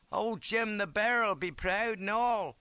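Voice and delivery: high, slow, drawn-out soldier's call; piping up. A narrator's reading. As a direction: pipe up from a sickbed. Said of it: "pipes up one chap".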